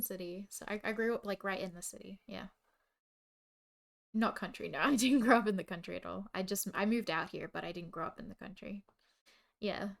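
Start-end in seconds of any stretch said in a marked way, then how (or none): 4.8-6.3 loud laughing denial; real laughter. Real, not acted. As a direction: laugh it off loudly.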